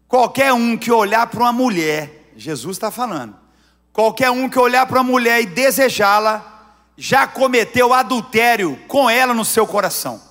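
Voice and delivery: loud, emphatic reading of Jesus' words; fervent scripture quote. Real, not acted. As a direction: proclaim it with full force. Quoting Jesus with force. (citação enfática, bem alta)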